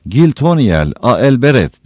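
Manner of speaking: loud, high rallying cry; shouted invocation. A narrator's reading. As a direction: call out the invocation boldly. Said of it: "diye bağırdı Sam".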